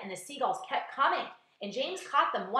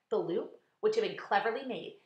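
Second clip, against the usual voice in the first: plain reading voice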